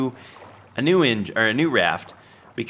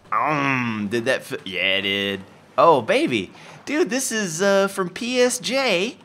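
usual speaking voice

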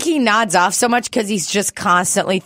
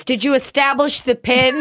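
imitating Rick's dogged question, higher; mock Rick asking; 6 semitones higher than usual. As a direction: play Rick asking the same thing, pitched up. Rick asks again, voiced high.

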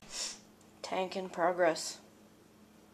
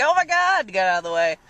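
exasperated yell; 8 dB louder than usual. Retold or imitated. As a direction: play the startled driver shouting. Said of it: "he's like".

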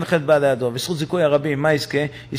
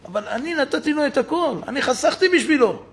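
much higher, indignant outburst; father's pitched-up indignation; angry father; 10 semitones higher than usual. Acted. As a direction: let the father's grievance rise. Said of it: "זה אבא התעצבן".

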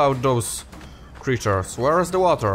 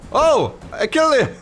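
high-pitched thrilled exclamation; surprised triumph; 12 semitones higher than usual. Real, not acted. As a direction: blurt out an unexpected victory. Wins fight to own surprise.

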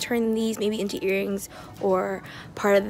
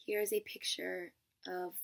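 whispering; 3 semitones lower, 10 dB quieter than usual. Real, not acted. Unvoiced whisper over photo.

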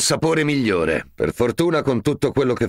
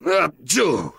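normal talking voice

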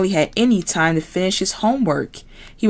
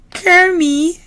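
very high squealy piggy voice; Miss Piggy voice; 13 semitones higher, 5 dB louder than usual. Acted. Miss Piggy to classmates, very high pig voice. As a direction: play the diva pig, high and bright.

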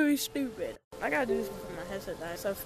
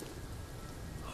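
gasp; 13 dB quieter than usual. Real, not acted. Sudden scare in the game.

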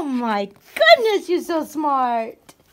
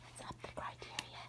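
whispering; 27 dB quieter, much faster than usual. Real, not acted. Aside while training dog.

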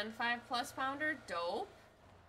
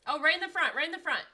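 loud, higher urgent callout; callout shout; 6 semitones higher, 12 dB louder, faster than usual. Real, not acted. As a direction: yell where the enemy is. Calling out enemy position.

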